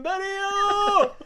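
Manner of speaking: loud, high, drawn-out name call; imitated screaming. Storyteller imitates friend still screaming as he approaches, loud high cry. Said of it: "he's still screaming".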